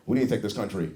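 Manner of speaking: a hint of a solemn politician on TV; mock Republican pundit. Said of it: "get on T V and be like".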